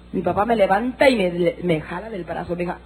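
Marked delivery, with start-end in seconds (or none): none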